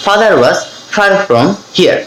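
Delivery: "Father was", pause, then a slow "far from here"; slow split drill. Shown as a demonstration. Teacher splits the drill into slow chunks. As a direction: chunk the sentence slowly. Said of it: "এভাবে কিন্তু আপনারা এগুলো বাসায় practice করবেন".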